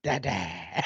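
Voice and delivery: lightly voiced toddler, low and flat; a toddler's cheeky low dada; low deadpan toddler dada. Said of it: "And then he'll say".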